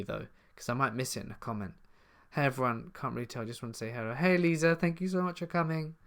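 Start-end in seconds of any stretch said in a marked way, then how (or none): none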